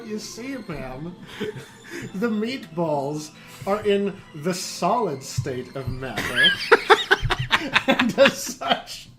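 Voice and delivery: slow, lofty explanation breaking into laughter; mock pedantic lecture. Narrator schooling the customer on physics, laughing through a mock lecture. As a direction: lecture her like a professor while cracking up. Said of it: "So I go".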